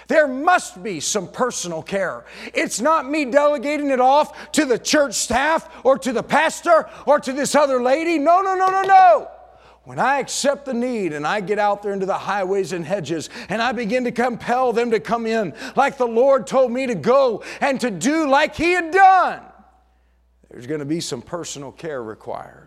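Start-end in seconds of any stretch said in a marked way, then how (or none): 2.5-8.2 loud, much higher climbing sermon voice; preaching at full force. Acted. 8.2-9.3 each "no" much higher and louder; fervent shouted refusal. Acted. Preacher refusing, rising climax. 9.8-19.4 loud, much higher climbing sermon voice; preaching at full force. Acted.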